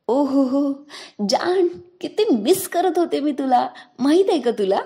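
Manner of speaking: joyful. Acted. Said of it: "सानूच्या आवाजात आनंद दिसत होता".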